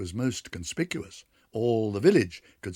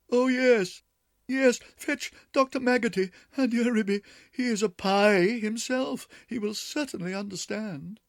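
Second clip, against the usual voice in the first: much higher, louder pleading; pleading whine; 13 semitones higher, 6 dB louder than usual. Acted. Duchess begging Ribby to fetch the doctor, whining. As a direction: beg her to fetch help.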